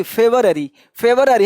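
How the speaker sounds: फेवररी said "FE-var-a-ree"; February without u. Shown as a demonstration. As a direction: drop the u, फेवररी in parts. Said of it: "ये यू को हटा दो".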